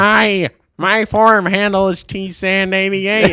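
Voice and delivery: high, slow nerd introduction; nerdy voice. Pretend. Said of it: "You can't do the nerdy voice".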